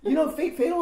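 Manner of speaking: high loud eager question; breathless with gossip; imitating excited friend. Friend's excited phone news.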